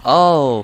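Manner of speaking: loud, high "oh" of dismay; real burst of alarm; alarmed cry. Real, not acted. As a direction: cry out as things go wrong. Sudden danger in game.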